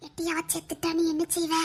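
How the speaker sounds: low, very soft murmur; secretive, ominous aside; hushed scare. Acted. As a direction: frighten a classmate under your breath. Pupils gossip behind teacher's back.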